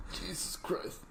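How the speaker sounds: strained breathy groan while stretching. Real, not acted. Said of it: "Sorry, I'm stretching".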